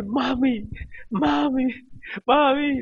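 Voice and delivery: high-pitched tearful calls to wake someone; mock tearful cry. Pastor retells waking his wife in tears, high mock wailing. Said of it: "llorando y, y le dije".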